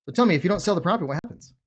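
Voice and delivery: the wrong way, hurried and pushy; rushed, pushy ask. Shown as a demonstration. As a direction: fire the question off too quickly. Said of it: "You guys see the difference?".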